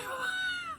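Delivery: a high squeal of a laugh. Real, not acted. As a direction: burst into a shrieking laugh. Laughing at strike one joke.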